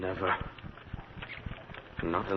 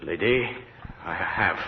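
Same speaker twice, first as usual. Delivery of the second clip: ordinary delivery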